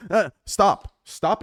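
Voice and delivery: sharp loud call to stop. Real, not acted. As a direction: cut yourself off loudly. Scolding himself loudly.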